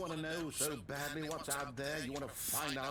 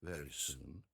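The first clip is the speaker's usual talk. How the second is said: vast deep cosmic voice; 4 semitones lower, 16 dB louder than usual. Acted. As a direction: speak like a distant god. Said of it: "He's talking like God".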